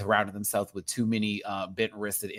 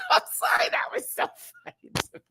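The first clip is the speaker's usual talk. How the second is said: usual speaking voice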